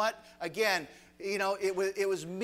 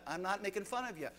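usual speaking voice

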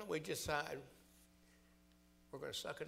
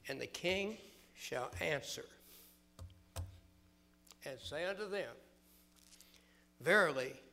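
preaching at full force; 7 dB louder than usual. Acted. Preacher reading the king's answer, loud then fading.

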